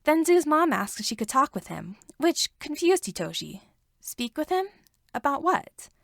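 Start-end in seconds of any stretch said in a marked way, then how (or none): none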